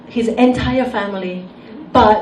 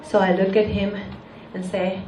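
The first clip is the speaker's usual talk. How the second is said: usual speaking voice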